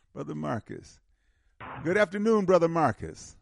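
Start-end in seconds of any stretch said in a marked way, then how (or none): 1.8-3.4 raised, higher call to a caller on the line. Real, not acted. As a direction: call out louder to get his attention. Host calling out to caller.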